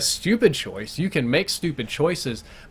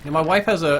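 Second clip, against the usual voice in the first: ordinary talk, nothing marked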